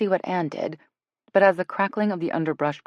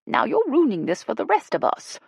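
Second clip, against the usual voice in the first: high-pitched, firm reproach; firm scolding; 8 semitones higher, 4 dB louder than usual. A narrator's reading. Ella telling Jill off for the group, firm. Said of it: "Ella said firmly".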